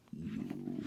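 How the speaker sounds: very low, breath-heavy growled word; menacing low voice. Retold or imitated. Mouse man confronts Bob, low drawn-out hushed menace. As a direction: draw it out low and quiet.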